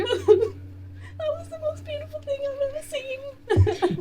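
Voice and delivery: high, slow, tearful voice; choked up with joy; weepy and awed. Retold or imitated. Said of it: "is actively weeping".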